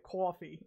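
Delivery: COFFEE said the way locals up here say it; regional pronunciation. Retold or imitated. Locals up here saying coffee, regional pronunciation. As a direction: imitate the local vowel in COFFEE. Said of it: "a lot of people will say".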